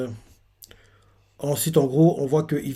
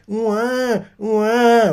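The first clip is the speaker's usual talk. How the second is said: high mock baby wail; baby crying; 8 semitones higher than usual. Narrator mimics baby Bonnie crying, high mock wail. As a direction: imitate a newborn's cry. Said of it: "bébé".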